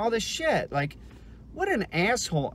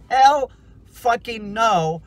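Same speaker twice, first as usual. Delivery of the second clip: slow and much louder, every word hammered; emphatic hell no; 4 semitones higher, 10 dB louder, slower than usual. Real, not acted. Telling viewers he couldn't have done that as a kid, slow loud emphatic. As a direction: belt it out as a flat refusal.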